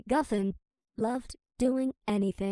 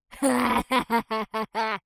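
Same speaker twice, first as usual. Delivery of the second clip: loud cackle; 7 dB louder than usual. Acted. Villain cackling after kill.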